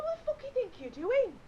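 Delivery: high, loud indignant challenge; angry. Retold or imitated. Confronting the man across the campsite, angry. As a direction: yell it in comic fury. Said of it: "never been so fucking angry".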